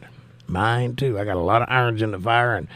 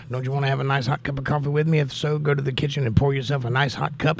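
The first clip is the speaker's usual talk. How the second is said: ordinary talk, nothing marked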